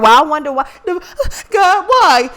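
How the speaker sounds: high, loud, whiny mock prayer on God, why; mock plea to God. Mocking someone asking the Lord why, rising high and loud. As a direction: ham up a self-pitying cry to God. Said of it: "Now you asking the Lord".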